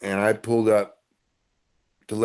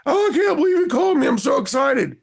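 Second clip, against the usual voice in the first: high, fast thrilled squeal, much higher; excited shout. Retold or imitated. Fan squealing to Ken that he's excited, high fast shout. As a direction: burst out shouting with joy. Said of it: "He's like".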